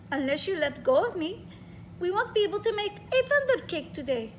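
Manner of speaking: put-on light Russian accent, coaxing. Grandma coaxing the scared child, Russian accent. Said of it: "I'm trying to read with a Russian accent".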